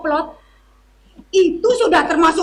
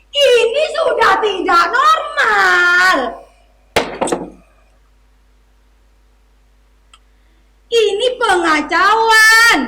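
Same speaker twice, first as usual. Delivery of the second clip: hammy stage actor; 5 semitones higher, slower than usual. Acted. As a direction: declaim it like a melodrama villain. Officer ranting about the disorder.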